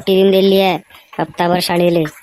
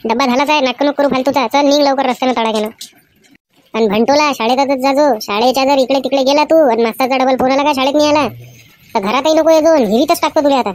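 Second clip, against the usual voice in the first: higher-pitched angry mother voice, fast at the end; mother yelling at her son to get ready for school; scolding mother; 4 semitones higher than usual. Acted. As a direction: nag the lazy son like a furious village mom.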